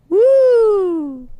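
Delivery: long, high, loud woo; celebrating crowd cheer. Acted. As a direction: whoop like a partying crowd. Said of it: "they also go".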